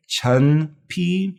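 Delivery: CHEN slow and high, with an uh vowel; careful pronunciation. Shown as a demonstration. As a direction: model "chen pi" slowly, e as uh. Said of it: "the E has an uh sound".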